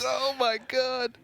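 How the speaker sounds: high, drawn-out amazed cry; astonished. Real, not acted. Co-caster reacting to a second Ragnaros, astonished. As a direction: stretch it out in amazement.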